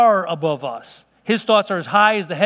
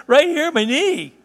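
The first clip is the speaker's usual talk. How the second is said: put-on whiny little girl; 8 semitones higher, slower than usual. Daughter answers where she's hurt.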